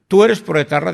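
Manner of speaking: lieutenant demanding to know if he backs ETA; loud accusing question. Retold or imitated. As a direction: throw the accusation at him hard. Lieutenant interrogating the recruit.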